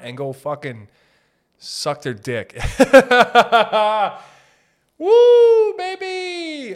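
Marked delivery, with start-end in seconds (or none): 2.6-4.2 big burst of laughter; loud cackling laugh. Real, not acted. 5.0-6.7 high loud whoop. Real, not acted. Excited whoop.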